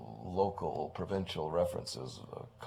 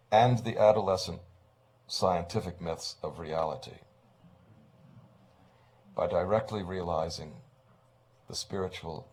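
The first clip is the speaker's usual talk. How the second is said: solemn title reading; 6 dB louder than usual. Acted. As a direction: proclaim each phrase like an engraved title. Reading the book's subtitle aloud.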